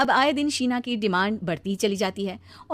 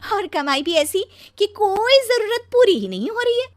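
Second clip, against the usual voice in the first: higher-pitched wifely lament, rising to a louder peak; aggrieved complaining; 7 semitones higher, 5 dB louder than usual. Acted. Wife lamenting her wrong marriage, loudly aggrieved. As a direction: gripe with growing exasperation.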